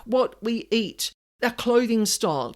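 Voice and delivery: ordinary delivery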